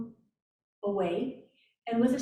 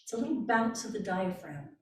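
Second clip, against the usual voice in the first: normal talking voice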